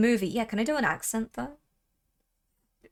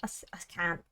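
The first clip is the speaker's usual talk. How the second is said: whispering; 5 semitones lower, 10 dB quieter, faster than usual. Real, not acted. Whispered refusal to do voice.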